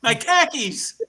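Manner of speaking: high-pitched mock Boston accent; puzzled Bostonian turning car keys into khakis. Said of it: "Boston's the only place".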